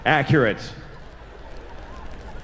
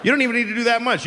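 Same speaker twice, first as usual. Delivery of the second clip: ordinary talk, nothing marked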